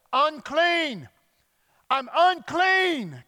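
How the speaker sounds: high, loud, drawn-out cry; yelled warning. Retold or imitated. Leper warns people walking up to him, yelled. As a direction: yell it slowly across a distance. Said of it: "required by law to yell".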